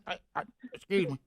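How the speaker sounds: put-on slurred, stumbling drunk; lightly voiced drunk Gary; drunk guy impression. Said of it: "your drunk guy impression".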